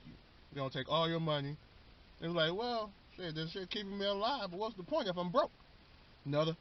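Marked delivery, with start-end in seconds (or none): 2.5-5.5 higher, softer old-timer impression; old person voice. Old person complaining about costly medicine, high soft elderly voice. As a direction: lightly put on an elderly voice, raised in pitch. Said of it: "old person voice".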